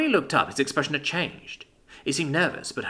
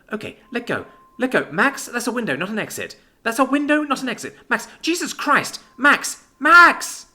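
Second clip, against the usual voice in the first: panicked; 11 semitones higher, 6 dB louder than usual. Acted. Libby panics at window.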